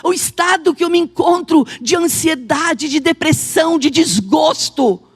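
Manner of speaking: emphatic, ringing preacher delivery; full-force preaching. Acted. Preacher proclaiming God's promises to the congregation, full-force preaching. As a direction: drive each phrase home, stretching key words.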